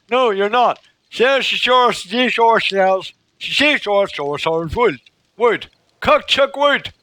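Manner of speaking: mangling the words in loud exasperation; frustrated tongue-twister shouts. Real, not acted. Tripping over the twister, loud and flustered.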